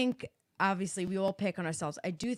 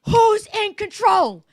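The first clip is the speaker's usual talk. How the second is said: higher, loud and slow shouting; 12 semitones higher, 16 dB louder, slower than usual. Retold or imitated. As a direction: drag each word out over the screaming. Retells yelling at screaming daughter.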